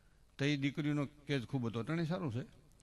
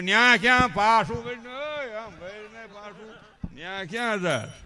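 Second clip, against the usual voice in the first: a hint of a loud fair-goer hollering; mock call after someone in the crowd; imitated calling out; 4 semitones higher, 8 dB louder, slower than usual. (મેળામાં કોઈને હળવી નકલમાં બૂમ પાડવી)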